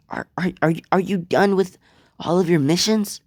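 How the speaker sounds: high, halting, eager question; hopeful stammer. Acted. Deku hopefully questions his returned brother.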